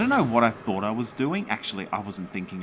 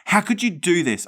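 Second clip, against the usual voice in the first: high, loud furious yell; angry shout; 9 semitones higher, 8 dB louder than usual. Acted. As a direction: shout at the ghost. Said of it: "I shouted again".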